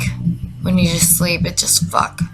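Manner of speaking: ordinary talk, nothing marked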